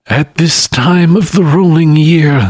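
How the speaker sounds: high lamenting spectre voice; sorrowful restless spirit; mournful ghost. A narrator's reading. The spectre speaks.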